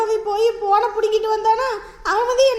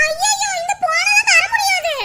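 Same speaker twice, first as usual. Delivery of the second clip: panicked refusal; 8 semitones higher than usual. Acted. Woman refusing robber her phone.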